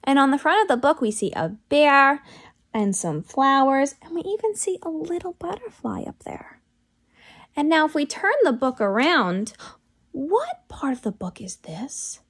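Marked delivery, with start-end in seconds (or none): 4.0-6.6 soft secret whisper; hushed storytime aside. Acted. Teacher whispers a detail.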